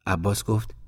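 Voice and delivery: ordinary delivery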